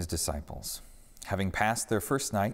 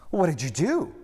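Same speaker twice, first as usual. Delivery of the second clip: alarmed; 8 semitones higher, 4 dB louder than usual. A narrator's reading. Peter asks alarmed.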